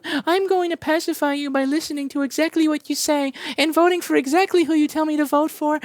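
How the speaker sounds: mock a spineless follower begging approval; meek people-pleaser. Mocking a viewer who votes as told, simpering and high. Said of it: "one of these people like".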